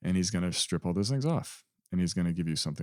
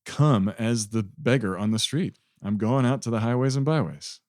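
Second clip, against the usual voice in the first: a touch raised and louder, eager welcome; lightly voiced Jesus inviting; 3 semitones higher, 4 dB louder than usual. Jesus calling the beggar in, rising eager invitation. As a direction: offer the feast gladly, voice lifting. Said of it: "That's this message from this parable".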